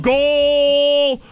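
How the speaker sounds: drawn-out shouted goal call; long goal yell. Retold or imitated. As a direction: belt out goal like an announcer. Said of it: "because he's been yelling".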